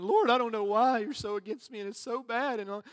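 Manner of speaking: whining. Acted. Preacher imitating weeping worshippers pleading with the Lord, whiny. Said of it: "That was just me whining".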